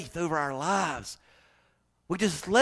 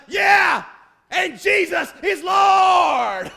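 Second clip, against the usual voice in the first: much higher, louder joking shout; mock cheer shout; 14 semitones higher, 10 dB louder, much slower than usual. Preacher imagining shouting at the game: joking loud cheer. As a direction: belt it like a fan in the stands. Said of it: "I feel like saying up".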